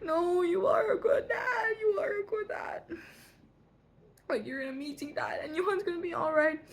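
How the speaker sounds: hammed-up crying plea, pitched high; imitated crying. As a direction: act out sobbing reassurance to a dad. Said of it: "I was, like, crying".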